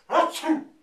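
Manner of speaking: sudden high, explosive sneeze; acted sneeze, high and loud. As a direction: burst out with a big mock sneeze.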